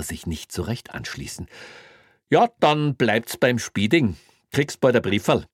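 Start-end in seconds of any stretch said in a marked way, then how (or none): 2.3-5.5 smug Bavarian cop. Acted. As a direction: wrap it up smugly. Greiner voiced in story.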